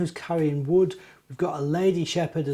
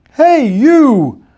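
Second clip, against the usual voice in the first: loud, high giant's bellow; a giant yelling at someone on his leg; booming giant; 8 semitones higher, 13 dB louder than usual. Acted. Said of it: "the rumble came from behind him".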